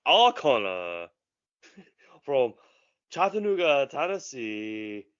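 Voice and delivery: slow, drawn-out ring announcer call; Japanese ring announcer. Pretend. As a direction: stretch every word like a ring announcer. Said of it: "Japanese ring announcer intro".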